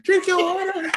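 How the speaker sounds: very high, squeaky motherly nagging; mother's fussing voice. Retold or imitated. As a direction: fuss like a mother hen, way up high. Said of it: "She was like".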